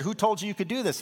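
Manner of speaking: accusing Pharisees. Retold or imitated. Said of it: "They said".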